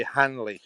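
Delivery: "Hanley" with an audible initial H; H pronounced. Shown as a demonstration. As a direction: sound the H at the front of "Hanley". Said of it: "I don't say the H's".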